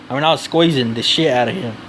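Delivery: ordinary delivery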